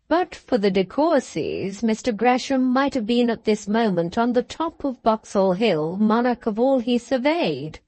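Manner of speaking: ordinary delivery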